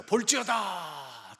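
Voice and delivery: loud, high, drawn-out declaration; scripture proclamation. Acted. Preacher quoting the words of Jesus, proclaimed loudly. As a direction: proclaim it slowly, stretching the word.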